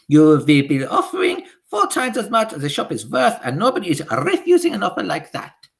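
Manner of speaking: high, loud witch voice with a foreign lilt; high imperious witch decree; Grand High Witch. Acted. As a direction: command the witches like a tyrant queen.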